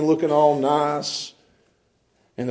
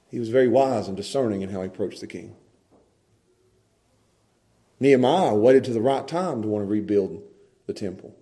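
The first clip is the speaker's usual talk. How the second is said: ordinary talk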